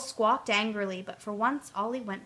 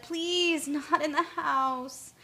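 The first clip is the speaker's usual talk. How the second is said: mother's plea; 6 semitones higher, much slower than usual. Acted. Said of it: "but Ollie's mother said".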